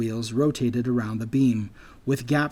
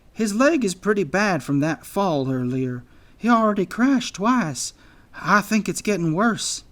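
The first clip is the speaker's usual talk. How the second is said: high-pitched worried voice; concerned anteater; 6 semitones higher than usual. A narrator's reading. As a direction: voice worry for an injured friend, pitched up. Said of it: "looked back at Sprint with concern".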